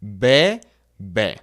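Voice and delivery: soft Spanish B, lips barely touching; gentle bilabial B sound. Shown as a demonstration. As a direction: say B softly, no hard pop. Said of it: "make a soft B sound".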